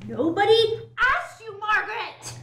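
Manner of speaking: high, loud acted scolding; angry outburst. Shutting Margaret up in a quarrel, angry outburst. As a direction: shout her down, pitched high.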